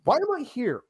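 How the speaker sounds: high, bewildered why; baffled question. Retold or imitated. Asking the crew why he was invited, high and baffled. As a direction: ask it like you can't believe it. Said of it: "I go".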